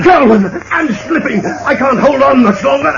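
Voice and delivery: high, desperate cry; clinging on for dear life. Acted. Hanging from the bridge.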